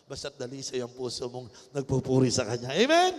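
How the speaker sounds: preacher rousing the congregation, loud; preaching at full force. Real, not acted. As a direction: build to a loud rallying cry. Preacher's climactic shout.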